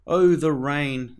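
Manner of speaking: usual speaking voice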